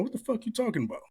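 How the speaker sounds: retold bafflement, voice jumping up; mock disbelief. Young man questioning older dude about nickname, incredulous.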